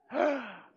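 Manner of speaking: sharp high gasp; rehearsed gasp. Shown as a demonstration. Pastor leading the congregation's practice gasp, sharp and high. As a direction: gasp as if shocked. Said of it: "insert that gasp".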